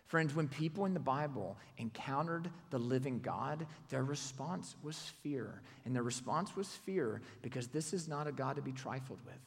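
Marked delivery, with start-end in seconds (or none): none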